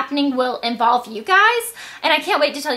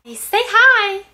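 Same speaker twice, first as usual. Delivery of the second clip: high, slow, loud cooing; high sing-song coaxing voice; 9 semitones higher, 5 dB louder, much slower than usual. Acted. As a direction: coax a baby to say hi. Coaxing someone to say hi.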